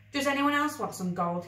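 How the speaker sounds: lightly voiced showing-off kid; showy offer. A narrator's reading. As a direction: dangle the prize before the crowd. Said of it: "he asked".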